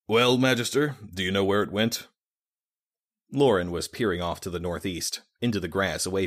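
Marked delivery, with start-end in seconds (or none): 0.1-2.1 brisk, matter-of-fact question; businesslike. Acted. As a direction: get straight to the point. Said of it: "all business again".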